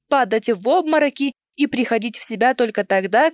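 usual speaking voice

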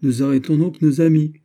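high, merry teasing; cheerful exclamation. A narrator's reading. Musketeer teasing the officer who arrests his friends, gaily. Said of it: "s'écria gaiement le mousquetaire".

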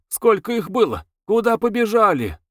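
high, clamouring onlookers; noisy crowd questions. A narrator's reading. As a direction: fire off questions like an agitated crowd. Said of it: "Публика шумно спрашивала".

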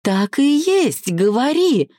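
high, excited insistence; very high-pitched, thrilled and impatient; gleeful anticipation. A narrator's reading. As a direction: demand the secret in a high eager squeal. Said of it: "горели её глаза предвкушением".

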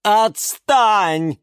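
snapping. A narrator's reading. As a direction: brush him off sharply. Diana snaps at Kirill.

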